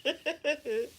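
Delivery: high-pitched amused giggle. Real, not acted. Streamer giggling at a chat message, high giggle. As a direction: let out a high little giggle.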